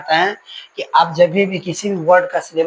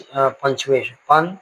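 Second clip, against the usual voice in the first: ordinary delivery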